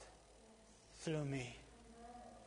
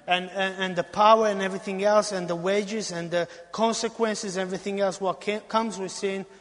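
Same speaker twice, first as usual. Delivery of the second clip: loud, driving preaching; preaching at full force; 3 semitones higher, 9 dB louder than usual. Acted. Preacher rousing the congregation, loud and driving. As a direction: hammer every phrase home.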